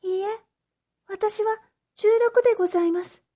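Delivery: high, quick, firm insistence; a girl refusing the way out; resolute young girl. Acted. Oshichi correcting the magistrate, high and firm.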